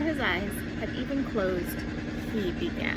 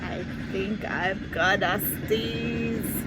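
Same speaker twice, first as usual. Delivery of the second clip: sleepy flea; 2 semitones lower, 6 dB louder, much slower than usual. Acted.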